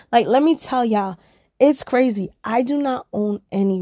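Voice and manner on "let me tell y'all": ordinary delivery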